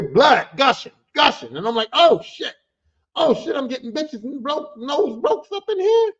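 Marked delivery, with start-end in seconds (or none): 1.9-6.1 high, alarmed oh shit; shocked exclamation. Retold or imitated. Seeing blood everywhere, shocked high exclamation. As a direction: caught off guard by the blood. Reacting to the broken nose.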